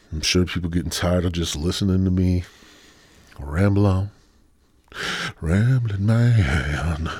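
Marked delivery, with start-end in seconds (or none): 5.4-7.1 very slow drawl. Real, not acted. Drawn-out self label.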